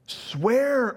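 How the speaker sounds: the proconsul urges Polycarp, high and forceful; urging proconsul. Retold or imitated. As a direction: push him hard to swear. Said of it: "urged the proconsul".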